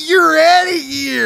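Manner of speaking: mock ejection call. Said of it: "I don't like what you just said".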